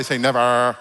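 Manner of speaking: mock Valley accent on NEVER; Simi Valley accent. Preacher getting congregation to say never, Simi Valley accent. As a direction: lean into a put-on Valley "never". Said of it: "Say it like you're from a valley, from, from Simi Valley".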